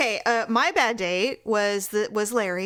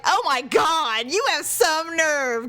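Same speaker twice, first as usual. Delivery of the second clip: slow, higher, outraged; mock indignant; 6 semitones higher, slower than usual. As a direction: drag out the disbelief. Her outraged reply.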